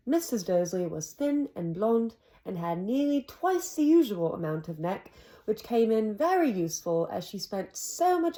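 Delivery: put-on amateur British accent for story narration. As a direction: narrate the book in a not-quite-perfect British accent. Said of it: "in my British accent".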